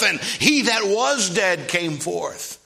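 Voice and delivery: loud, high revival preacher; full-force preaching. Real, not acted. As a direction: drive it out to the whole church, high and loud.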